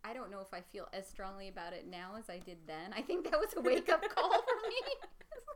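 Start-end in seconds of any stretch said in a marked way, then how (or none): 3.0-5.5 laughing. Real, not acted. Laughing while telling.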